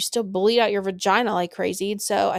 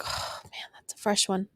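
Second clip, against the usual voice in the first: whispered, much softer, half joking; hushed aside; 7 dB quieter than usual. Retold or imitated. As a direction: breathe it out like a grim little secret. Passing the preemie room quip.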